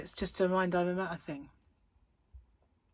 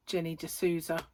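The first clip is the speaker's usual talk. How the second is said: ordinary delivery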